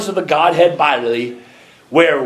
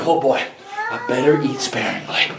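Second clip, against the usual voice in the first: lower, softer, worried little mouse voice; timid little mouse; 5 semitones lower, 6 dB quieter than usual. Pretend. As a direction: fret like a stingy little mouse. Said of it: "they said".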